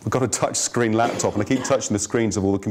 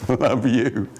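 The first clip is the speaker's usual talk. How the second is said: drawn out, teasing and sarcastic; a girl mocking a boy's crush with a slow sneer; slow mocking I love you; much slower than usual. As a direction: stretch it out to mock him. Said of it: "And she went".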